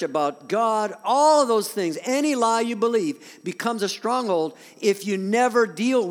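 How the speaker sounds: loud, high, driving sermon voice; preaching at full force. Acted. Pastor preaching hard to the church, loud and driving. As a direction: bring it home with full force.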